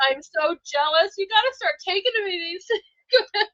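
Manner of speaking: high, loud laughing tease; giggling jealously at a friend. Real, not acted. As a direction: laugh through it while teasing. Laughing, teasing cohost.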